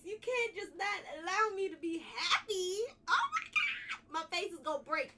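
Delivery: talking through real laughter; can't stop grinning, laugh it out. Real, not acted. Streamer laughing at the chat's reaction.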